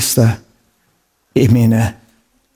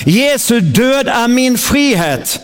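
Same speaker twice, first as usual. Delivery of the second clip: raised, proclaiming preacher's voice, line by line; preaching at full force; 9 semitones higher, much slower than usual. Acted. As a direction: declare each line like a battle cry for the church to echo. Leads congregation in declaring scripture.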